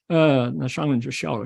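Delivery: usual speaking voice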